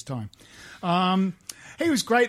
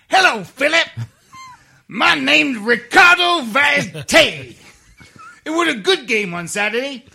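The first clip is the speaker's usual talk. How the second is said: loud, high, hammed-up street accent; impersonation of a footballer; 7 semitones higher, 9 dB louder than usual. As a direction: shout it like a brash striker. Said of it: "that wonderful impersonation you did".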